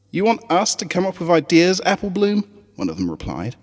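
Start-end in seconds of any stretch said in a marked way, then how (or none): 0.1-2.4 put-on higher, skeptical young filly; a doubtful little pony teasing her friend. Scootaloo doubting Apple Bloom's speech, high and skeptical.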